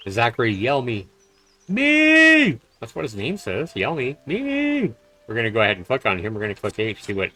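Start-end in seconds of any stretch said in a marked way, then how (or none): 1.7-2.6 high squeal on Me; a silly high yelped Me. Pretend. Joke reading of name Yelmy. 4.3-4.9 high squeal on Me; a silly high yelped Me. Pretend. Repeats name joke.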